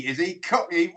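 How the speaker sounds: ordinary talk, nothing marked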